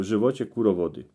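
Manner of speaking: normal talking voice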